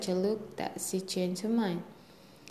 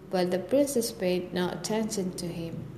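usual speaking voice

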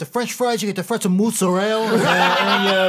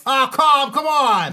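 higher, louder New Jersey mobster yell; mock Tony Soprano yell; 8 semitones higher, 6 dB louder, slower than usual. Father yelling at his son AJ for disco fries, in a loud mock mobster voice. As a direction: bellow at the kid like a Jersey mob dad.

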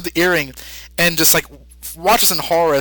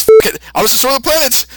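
Buu; 8 semitones higher, 6 dB louder than usual. Retold or imitated. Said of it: "Buu says".

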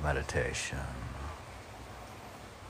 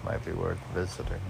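usual speaking voice

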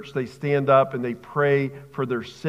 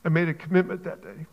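real choked-up emotion, halting with long pauses; emotional, choked up. Real, not acted. Preacher pleading for suffering churches, choked up. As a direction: fight back tears, leaving long silences between phrases. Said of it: "Sorry for getting emotional".

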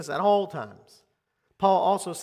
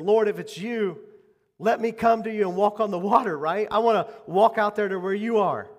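eager plea; 5 semitones higher, 7 dB louder than usual. Retold or imitated. Peter calls to Jesus on water.

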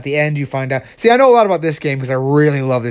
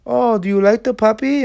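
high put-on doctor voice; coaxing evil doctor; 9 semitones higher than usual. Doctor to child playing with puppy, coaxing. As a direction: coo it in a higher-pitched voice.

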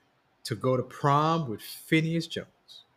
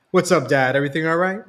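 eager reply; 5 semitones higher, 8 dB louder, faster than usual. A narrator's reading. Son answers father's summons.

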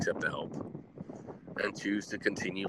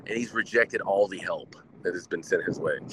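ordinary talk, nothing marked

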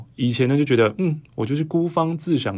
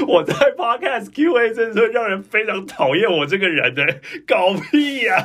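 mock whiny complaint; 9 semitones higher than usual.

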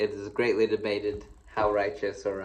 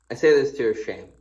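louder, faster, pointed rebuke; emphatic reading; 2 semitones higher, 7 dB louder, faster than usual. Shown as a demonstration. Preacher rereading the verse to the church, louder and faster. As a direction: read it as a sharp scolding.

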